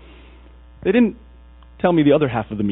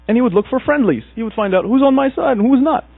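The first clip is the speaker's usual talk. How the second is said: raised, pushy king voice; a hint of the king; 11 semitones higher, 5 dB louder than usual. King searching the village for friendlies, raised and commanding. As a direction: puff up as the invading king.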